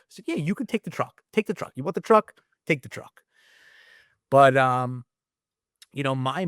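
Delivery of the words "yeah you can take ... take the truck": high repeated urging; high, insistent retold offer; mock insistence. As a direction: keep pressing him, pitched way up.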